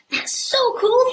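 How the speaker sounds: put-on high, loud boyish delight; excited boy. Jack marvelling at the golden eggs, high and excited. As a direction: squeal it like a thrilled kid.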